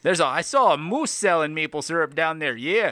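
lightly hammed-up Canadian accent; apologetic caricature Canadian. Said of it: "I've done the Canadian accent".

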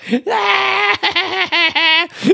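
long, very high-pitched mock scream; loud, sustained child-like squeal; imitated kid's scream. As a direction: screech like a hyperactive four-year-old. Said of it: "screaming, running around".

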